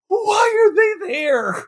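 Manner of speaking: high, pleading disbelief; exasperated wail. Real, not acted. Co-host baffled by pointless scene, wailing in exasperation.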